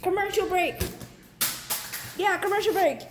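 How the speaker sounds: high, loud hyped announcement; loud announcer shout. Acted. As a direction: call it out like a show host. Announcing commercial break.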